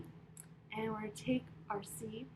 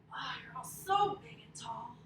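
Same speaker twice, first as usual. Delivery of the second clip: ordinary talk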